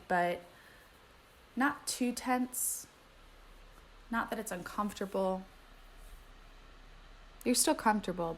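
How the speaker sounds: calm, unhurried meditation guide voice; guided meditation. Acted. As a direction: ease them gently into stillness.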